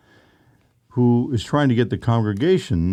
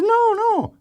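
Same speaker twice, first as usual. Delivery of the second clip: squeaky denial. Retold or imitated. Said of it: "He said".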